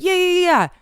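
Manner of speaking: high, brushing-off yeahs; a mocked ex shrugging it off; mock dismissive yeah. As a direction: imitate him waving it away. Mocking ex's dismissive reply.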